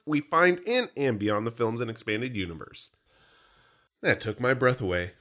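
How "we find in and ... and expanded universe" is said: show intro. Acted. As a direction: launch loud with stretched words, easing down by the end. Reads the show's introduction.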